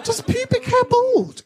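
high, slow, laughing disbelief; host reacting to a balding confession, incredulous. Real, not acted. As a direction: squeal it in shock. Disbelieving question to guest.

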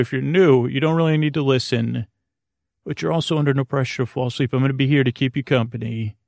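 ordinary talk, nothing marked

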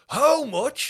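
high, loud mock dad asking the price; outraged dad. Dad at the kiosk, shocked by the price, high and loud. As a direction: ham up the scandalised father. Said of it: "dads there with the two co".

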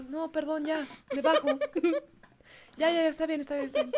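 high, sheepish backing off with a laugh; retelling her embarrassed retreat, laughing; laughing apology. Retold or imitated. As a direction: giggle out a flustered apology. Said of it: "y yo".

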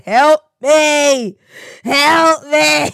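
loud, high begging cry to God; hammed-up wail of help me; mock desperate plea. Praying to God over hay fever, loud mock plea.

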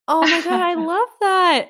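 very high, slow gushing; high delighted squeal. Real, not acted. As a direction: gush with delight. Delighted by rose prop.

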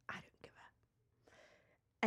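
slow breathy whispered aside. Acted. Reading a listener's cheeky aside to the hosts, slow whisper. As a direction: whisper it like a naughty secret.

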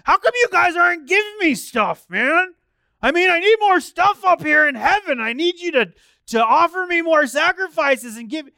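high, loud, whining complaint; mock needy God. Mock God begging for offerings.

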